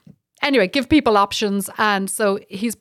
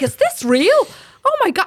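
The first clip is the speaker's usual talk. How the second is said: high, louder imitation of a stunned daughter; amazed disbelief; 11 semitones higher, 4 dB louder than usual. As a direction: act out a daughter who can't believe it. Daughter's amazed reaction, retold.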